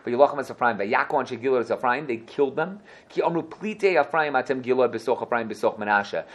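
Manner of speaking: ordinary talk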